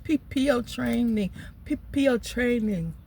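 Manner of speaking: imitating the manager's P P O; PPO for BPO. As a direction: say "P P O" the way the manager mispronounces it. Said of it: "it sound like".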